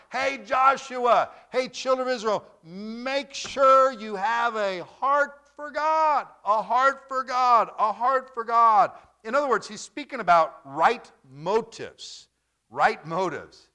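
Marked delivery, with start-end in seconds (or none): 0.1-8.9 high, loud calling out to a crowd; calling as Moses. Retold or imitated. Moses calling to the children of Israel, loud and high. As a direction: proclaim it like a prophet calling across a camp.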